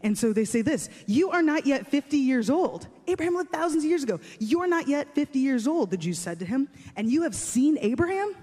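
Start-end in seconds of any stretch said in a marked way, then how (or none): 1.1-5.9 higher-pitched, incredulous objection; confused disbelief. Acted. 7.0-8.3 higher-pitched, incredulous objection; confused disbelief. Acted.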